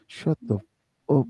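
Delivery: menacing mutter inches from her face; quiet threat through teeth. Shown as a demonstration. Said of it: "was like".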